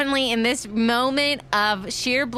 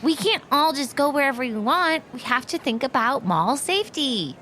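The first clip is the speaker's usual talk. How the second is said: Peach character voice; 4 semitones higher than usual. Acted. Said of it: "I'm using a character voice as well".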